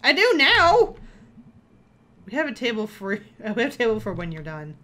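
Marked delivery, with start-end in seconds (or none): 0.0-1.0 high, loud sudden outburst; shouting. Acted. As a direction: blurt it out in a shout.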